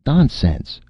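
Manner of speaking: high, loud dismissal; rally your nerve and dismiss it; rallying courage. A narrator's reading. Martin rallying before the director, bold denial. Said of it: "rallying his courage".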